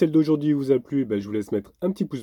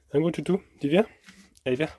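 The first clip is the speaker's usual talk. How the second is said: higher, coaxing call; talking to a dog; 6 semitones higher than usual. Real, not acted. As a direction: coax the dog over. Calling the dog. (appeler le chien d'une voix câline)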